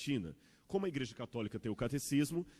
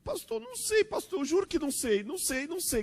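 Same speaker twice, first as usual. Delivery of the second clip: put-on very high child's voice on the edge of tears; a tearful little girl swearing she doesn't know; the girl pleads with the pastor, about to cry; 4 dB louder than usual. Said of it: "Começou a chorar".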